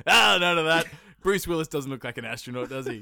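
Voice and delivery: loud dismissive mock official; mock NASA boss. Mocking the film's planners, loud dismissive boss voice. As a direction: brush the idea off like a pompous chief.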